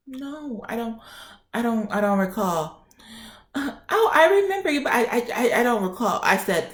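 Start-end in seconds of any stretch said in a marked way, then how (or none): 0.1-2.9 small, soft mimicked denial; imitated nurse. Nurse denying it to the patient, soft imitated hesitation. 3.5-6.2 high put-on voice, stammering and sinking in pitch; flustered nurse imitation. Nurse backtracking to the patient, high flustered mimicry.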